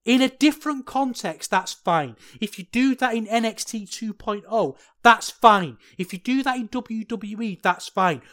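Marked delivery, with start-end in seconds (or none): none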